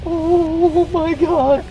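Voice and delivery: high, drawn-out shock. Real, not acted. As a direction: stretch it out in disbelief. Monkeys swarming.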